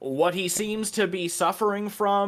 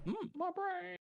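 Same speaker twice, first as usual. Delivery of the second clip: tiny, high, soft mimicking voice; mock echo; 13 dB quieter than usual. Player teasing the one checking their brain, mock echo. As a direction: squeak it softly, much higher than usual.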